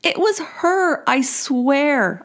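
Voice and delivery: high, slow, desperate protest; exclaiming. Retold or imitated. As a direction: plead your innocence, pitching way up. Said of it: "I exclaimed".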